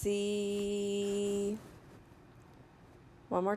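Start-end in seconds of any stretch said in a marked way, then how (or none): 0.0-1.6 "See" with long s and long ee; slow sounding-out. Shown as a demonstration. Teacher models a word for children, "See" drawn out slowly. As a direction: stretch "See" slowly on a level tone. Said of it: "saying the word slowly".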